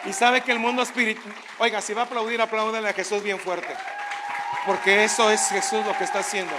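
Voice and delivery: loud, driving sermon cadence; preaching at full force. Acted. Preacher rallies the congregation about the spiritual world, loud. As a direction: rouse the congregation.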